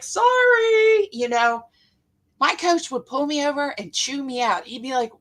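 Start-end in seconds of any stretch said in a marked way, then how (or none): 0.0-1.1 high-pitched, loud apologetic sorry; mock-timid teen apologizing on court; reflexive sorry. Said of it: "I'd be like".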